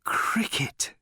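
breathed out with pleasure; pleased whisper. A narrator's reading. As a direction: savour it in a whisper. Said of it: "he added with pleasure".

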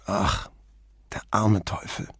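soft, saddened sigh of pity; lightly voiced, mourning a poor soul; grieved. A narrator's reading. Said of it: "sagte der Barmann bekümmert".

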